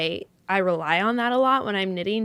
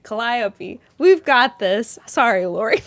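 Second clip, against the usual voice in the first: ordinary talk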